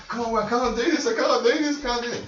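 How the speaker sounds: high, frantic begging, voice climbing; panicked pleading. Acted. Panicked prisoner begs the guards to let him go, frantic. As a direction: beg in terror, near breaking. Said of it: "He's now in panic mode".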